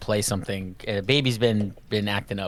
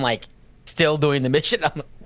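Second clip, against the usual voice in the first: normal talking voice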